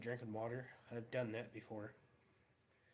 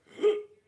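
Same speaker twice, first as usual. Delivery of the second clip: sudden loud high gasp for air; sharp gasp; 17 dB louder than usual. Retold or imitated. A sharp loud gasp as icy vapor hits the throat. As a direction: suck in air like the cold just hit.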